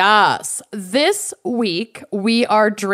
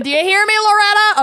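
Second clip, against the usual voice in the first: high, loud calling out; 10 semitones higher, 5 dB louder, much faster than usual. Retold or imitated. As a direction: call out across the room. Quoting a movie line, calling out.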